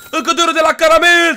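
high, loud announcer yell; hyped donation shout. Real, not acted. Streamer announces another gift from Caramel, hyped shout. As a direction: belt out the donation with hype.

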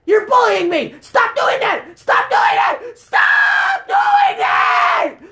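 high panicked yelling, building to screams; exasperated gamer meltdown; frantic outrage. Real, not acted. Enemies gang up in game.

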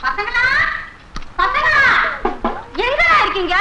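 high searching call. Acted. Mother looks for her boys, high calling voice. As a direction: call the children, voice lifted.

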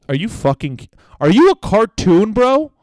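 loud, high, rising disbelief; mock incredulous. Mocking the man's cartoonish remark, loudly incredulous. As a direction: blurt it out in incredulous protest.

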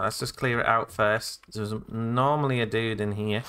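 normal talking voice

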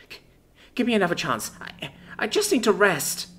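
high-pitched, stammering last plea; desperate pleading. A narrator's reading. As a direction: plead in desperation, pitched well up, tripping over words. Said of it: "cried out in a final burst of desperation".

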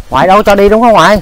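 loud, high question to grandma; calling out. Real, not acted. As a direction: belt out a question seeking her agreement. Turns to ask grandmother, raising voice.

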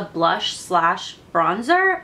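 slowly, each word set apart; slow down to get through the tongue twister; careful slow pace. Real, not acted. Beauty vlogger getting through a tongue twister, very slowly. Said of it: "say that really slow".

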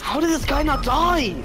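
high, loud, drawn-out disbelief; exasperated yell. Real, not acted. Player shouting as the enemy survives, exasperated yell. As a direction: cry out in real disbelief.

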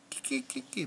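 high, soft tittering noise; squeaky pretend snickering; mock giggle. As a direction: imitate a giggle in a tiny high voice. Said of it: "Mommy's laughing".

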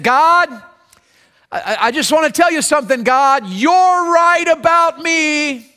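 pleading prayer. Acted. Sinner's prayer acted aloud.

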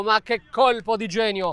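usual speaking voice

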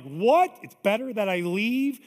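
shocked, loud rising question; disbelieving disciples. Retold or imitated. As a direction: blurt it out in disbelief. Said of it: "the disciples are like".

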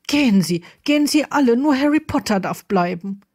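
insistent, jumping high in the middle; distraught, urgent dismissal; agitated order. A narrator's reading. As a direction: send them away in rising agitation. Distressed man orders everyone else away.

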